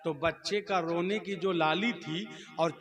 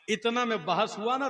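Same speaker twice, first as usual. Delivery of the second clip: ordinary talk, nothing marked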